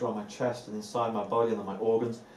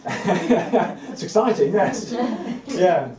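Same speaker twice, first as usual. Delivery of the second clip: laughing; 7 semitones higher, 5 dB louder, much slower than usual. Real, not acted. Laughing excitement about sharing all.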